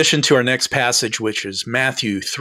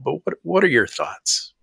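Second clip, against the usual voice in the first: normal talking voice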